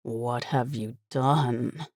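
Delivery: soft, slow, low despairing; hollow with shock and grief; quiet despair. Acted. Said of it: "more quietly, his voice full of despair".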